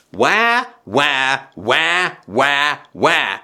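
mock baby wail, wah wah; a grown man doing a baby's cry; baby crying imitation. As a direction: imitate a crying infant lightly. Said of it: "here's my imitation of your son".

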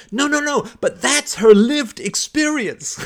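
high, loud, hammed-up indignation; mock upset protest. Debater rebuts the facts, mock upset protest. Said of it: "got really annoyed and angry and upset".

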